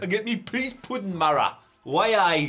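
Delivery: hammed-up Geordie accent. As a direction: play a Geordie local, broad. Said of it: "do the entire news in Geordie".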